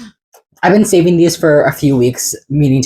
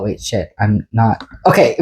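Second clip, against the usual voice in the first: ordinary talk, nothing marked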